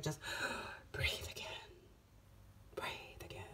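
a gasp, then a hushed sigh of relief; relieved whisper. Pretend. Tarot reader showing Cancer the relief to come, whispered. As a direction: breathe the words out in a whisper. Demonstrates breathing freely again.

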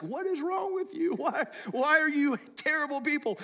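high, incredulous put-on voice of an exasperated son. Pastor voicing his son scolding the unmoved congregation, high and incredulous. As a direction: squeak it out like a baffled, scolding kid. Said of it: "He's like".

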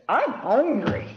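little bear says he's hungry, slow and high. A narrator's reading. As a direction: whine it like a small hungry cub. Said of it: "And the little bear says".

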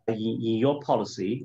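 ordinary talk

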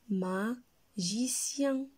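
Magicien said very slowly, each syllable apart. Shown as a demonstration. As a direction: draw out Magicien for pupils. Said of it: "Un magicien". (Magicien très lentement, syllabe par syllabe)

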